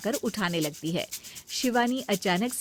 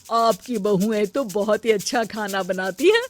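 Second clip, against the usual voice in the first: louder, effusive auntie voice; auntie praising; 5 dB louder than usual. Acted. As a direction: gush over the food like a guest. (मौसी जी सुषमा जी से खाने की तारीफ़ करती हैं, जोशीली आवाज़ में)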